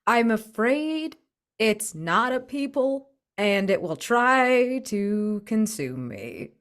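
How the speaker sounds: ordinary delivery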